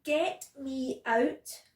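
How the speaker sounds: slow, loud demand; shouted. A narrator's reading. Wriggling child out shopping, shouting. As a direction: shout it out, each word stretched. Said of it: "in the end I shouted".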